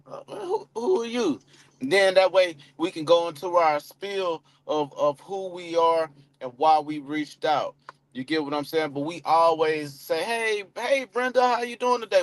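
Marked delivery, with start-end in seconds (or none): 0.1-1.4 much higher, puzzled mock caller; confused lead. Lead answering an unknown caller, mock confused. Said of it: "somebody to be like". 10.2-12.2 higher and quick, full of pep; upbeat greeting. Shown as a demonstration. Caller greeting Brenda with upbeat energy. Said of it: "We hit 'em with that".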